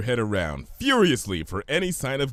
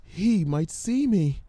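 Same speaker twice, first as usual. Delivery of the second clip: slow, hushed, worried; mild fear; 7 semitones higher, slower than usual. Acted. Fluttershy fears Gummy will see her, slow and nervous. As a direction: glance around as you say it. Fearful hiding pony.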